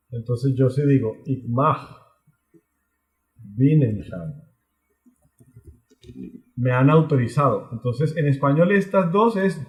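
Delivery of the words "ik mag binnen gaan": slow model phrase. Shown as a demonstration. Teacher models Dutch.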